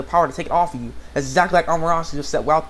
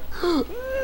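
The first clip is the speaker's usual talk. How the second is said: sharp imitated gasp; dramatic acted intake of breath; mock shocked gasp; 4 dB quieter than usual. Yukio seeing his brother fall, hammed-up gasp.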